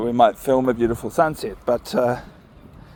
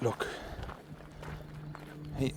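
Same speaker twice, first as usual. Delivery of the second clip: ordinary talk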